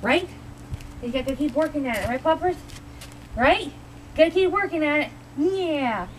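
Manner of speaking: talking to the dog. Real, not acted.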